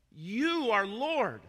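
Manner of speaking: high, loud and very slow; loud slow worship declaration; drawn-out proclamation. Acted. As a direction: stretch every word like a fervent cry. Quoting believers in worship.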